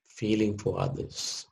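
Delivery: usual speaking voice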